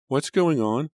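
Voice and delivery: jolted awake and frightened; alarmed. A narrator's reading. Mother woken at night, alarmed.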